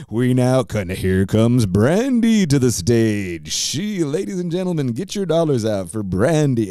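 mock stage announcer, high and drawn out; hammed-up emcee introducing a dancer, voice pushed up; strip club announcer. Announcer calling the dancer to the stage, hammed-up showman imitation.

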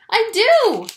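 high, loud insistence; frantic little shout; desperate cry. A narrator's reading. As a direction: stamp your foot on it. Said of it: "cried Lou".

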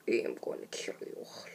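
low, soft menacing whisper; low whispered death threat; villain threat. Acted. Trying villain voices.

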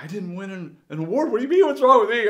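high, loud kid's protest, acted out; upset, near tears. Kid in back seat answering mom and dad, upset near tears. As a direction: imitate a kid about to burst into tears. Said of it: "about to burst into tears".

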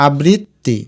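আবৃত্তি as abrit, ti; split before ti. Shown as a demonstration. Teacher asking students to try it, আবৃত্তি split abrit-ti. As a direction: pause on the doubled t of আবৃত্তি. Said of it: "উচ্চারণটা করে দেখো".

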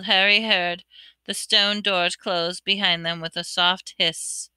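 ordinary delivery, nothing marked

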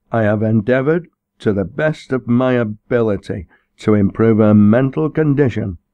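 stiff, formal self-defence; a touch of a pompous vicar; stiff parson. A narrator's reading. Barham voiced stiffly.

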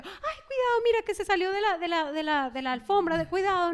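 high, fretful warning easing down; acted-out fussing over a baby; alarma de madre. Retells panicking at crawling baby. (alarma exagerada, aguda al empezar)

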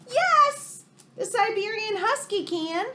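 very high loud yes; loud thrilled reveal; excited shout. Acted. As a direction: shout the answer like a cheer. Answers refrain excitedly.

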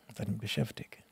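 breathed, voiceless repeat to himself; whisper. Real, not acted. Recalling his loneliness in Germany, whispered to himself. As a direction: let the words sink to a whisper.

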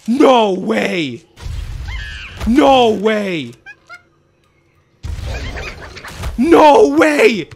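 gasp, then cry out in real disbelief; amazed outburst growing to a shout; stunned excitement. Real, not acted. Stunned reaction to surprise reveal.

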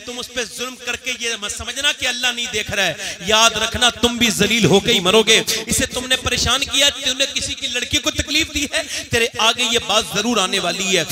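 loud, stern preaching; fiery warning. Acted. Preacher warning husbands who beat wives, loud and stern. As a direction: thunder the warning at the abusers.

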